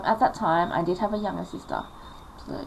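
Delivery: ordinary delivery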